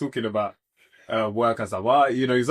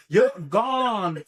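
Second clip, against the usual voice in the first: higher, stretched Jamaican exclamation; mock Jamaican accent; 5 semitones higher than usual. Speaker showing Jamaican old English word, high mock Jamaican accent. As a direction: imitate a Jamaican mum pronouncing judgement. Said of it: "This is the thing about Jamaicans".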